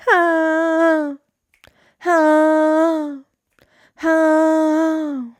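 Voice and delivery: high, loud hammed-up "Ha" shouts; theatrical ha shouts to close the tequila jingle; extra ha. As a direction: belt each ha out like a stinger. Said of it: "I added extra ha into that".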